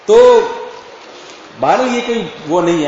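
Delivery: usual speaking voice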